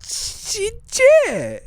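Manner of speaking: very high squeezed-out burst; block, then squeal the word out; Harlem Nights stutterer. Retold or imitated. Answering about a whipping as the movie stutterer, squealed stammer. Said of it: "Harlem Nights dude with the stutter".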